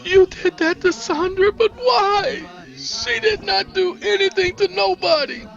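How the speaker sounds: loud, very high, tearful cry; distraught wail. Acted. Niecy protesting what Henrik did to Sandra, distraught and tearful.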